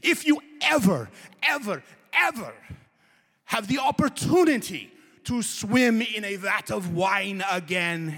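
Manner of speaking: loud, high, slow mock rant of a furious European; screaming and hollering. Tutor scolding the naked wine swimmers, hammed-up high shout. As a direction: ham up an outraged tutor scolding the students. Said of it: "he's screaming and hollering".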